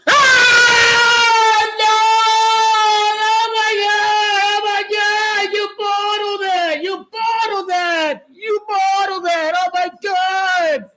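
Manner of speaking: high, loud cry of disbelief; anguished shouting. Real, not acted. Streamer reacting to a bottled shot, anguished shouting. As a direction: wail at a bottled chance.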